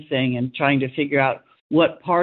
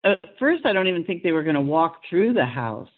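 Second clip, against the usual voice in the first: ordinary delivery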